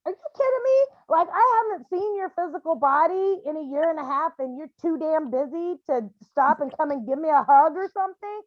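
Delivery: a mother hurt and furious, demanding a hug; the mother protests to her son who is too busy for her; indignant outburst. Retold or imitated.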